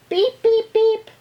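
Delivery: high, loud horn beeps; taxi horn. Acted. Reading of New York traffic, high taxi-horn beeps. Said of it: "Maybe you've heard".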